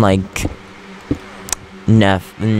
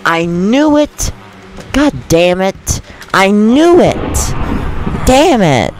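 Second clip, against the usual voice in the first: real frustration; 7 semitones higher than usual. Real, not acted. Player whose room got blown up by a creeper, furious cursing. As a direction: vent real annoyance at the game. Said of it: "that pissed me off".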